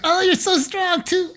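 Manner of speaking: very high, slow breathy-girl mock voice; hammed-up falsetto swoon; imitating a swooning girl.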